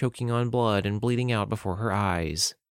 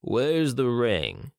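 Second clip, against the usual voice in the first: slow, drawn-out demand; menacing drawl; 2 semitones higher, much slower than usual. Acted. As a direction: stretch the words as an interrogator. Older man repeats drawled demand.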